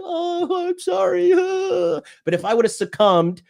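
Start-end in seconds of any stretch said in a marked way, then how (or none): 0.0-1.3 much higher, squeaky mock girly apology; mocking the girlfriend's apology in a high put-on voice. 1.4-2.0 high mock giggle. Laugh after mock voice.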